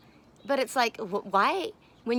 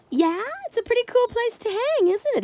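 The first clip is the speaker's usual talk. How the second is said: high, louder cooing to the cat; baby talk to pet; 7 dB louder than usual. Acted. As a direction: coo at the pet in a sing-song.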